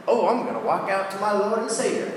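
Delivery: mock excited announcement; hammed-up eagerness. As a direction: play a naively keen disciple. Acts out eager Peter setting out.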